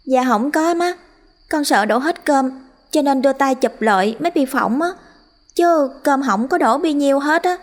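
put-on high, teary child voice pleading; scolded daughter near tears before her mother; tearful girl. A narrator's reading. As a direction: hold back tears while explaining. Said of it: "rưng rưng nước mắt".

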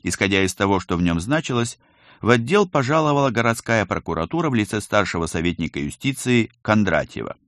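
plain reading voice

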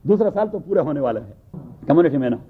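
usual speaking voice

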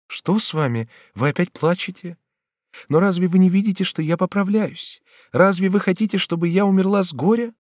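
very high, tender reproach; concerned Batilda. Acted. Batilda sees papa crying, concerned. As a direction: question him anxiously and gently.

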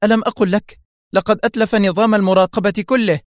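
high, sharp outburst; sharp shout. A narrator's reading. Boss to Carlo as all screens go dark, sharply. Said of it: "فصاحت في حدة".

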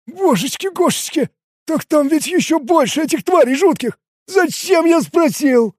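high, whiny, panicked voice; comic dread. A narrator's reading. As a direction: lament it in a high voice, dragging the last words. Frightened Ken regrets asking. (жалобная паника высоким голосом)

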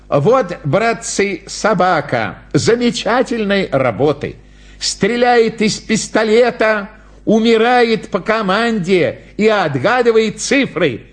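high fairground pitch to the crowd; clown's patter. Acted. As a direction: present the dog act in a high showman's voice. (балаганный зазывала, высоким голосом)

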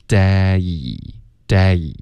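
DAY stretched from open eh to ee; slow, wide slide through "day"; gliding day. Shown as a demonstration. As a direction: open the mouth, then glide on "day". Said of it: "要有滑动".

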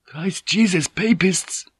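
high-pitched, dismayed mutter. A narrator's reading. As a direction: mutter an oath in alarm, voice lifted high. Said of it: "someone muttered".

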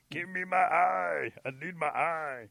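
high, loud, slow strained plea; little smashed possum. Acted. Smashed Possum begging for his eyeball, straining. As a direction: wail it out as a squashed creature. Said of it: "from that little smashed possum, you hear".

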